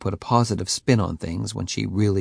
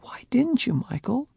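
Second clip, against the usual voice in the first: hurt question. A narrator's reading.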